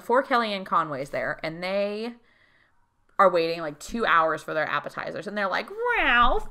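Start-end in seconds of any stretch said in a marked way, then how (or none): 6.0-6.5 high, loud mock complaint; impatient diner. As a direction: call out like a whiny, fed-up customer. Impatient diners calling their waiter.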